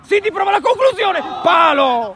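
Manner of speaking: high loud shout at the post; excited shout. Real, not acted. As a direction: yell the shot. Shot hits the post. (urlo concitato)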